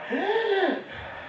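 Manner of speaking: put-on sharp high gasp, like a child who can't breathe; a choking child's sudden gasp; imitated gasp for air. Shown as a demonstration. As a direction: act out the child's airway closing with one gasp. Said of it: "I still can do it quite well".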